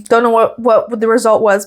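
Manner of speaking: usual speaking voice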